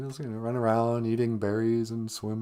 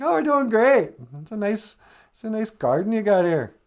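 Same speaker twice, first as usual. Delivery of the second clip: cheery high reply; 11 semitones higher, 6 dB louder than usual. Acted. Adam and Eve answering God.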